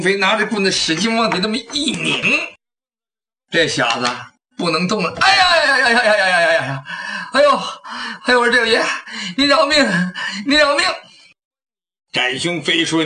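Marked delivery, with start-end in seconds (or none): 5.2-10.9 high pleading whine of a pinned crook, rising to a sob; a cowardly thief howling for mercy; wailing thief. Acted. Thief wails under the hero's stick. (小贼哭喊求饶)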